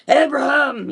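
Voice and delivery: booming voice of God calling a name; big deep voice. Retold or imitated. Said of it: "this big, deep voice".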